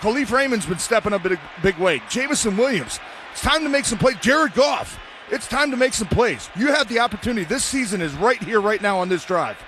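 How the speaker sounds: rally the team before the big drive; fired-up commentator. Real, not acted. Color commentator pumping up the team.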